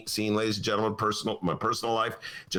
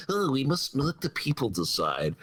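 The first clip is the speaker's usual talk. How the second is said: put-on politician's voice, lightly mocking; mock a senator's pious pronouncement; Mitch McConnell; 3 semitones higher than usual. Senator claiming the people should decide, mocking imitation. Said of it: "I will now do my Mitch McConnell imitation".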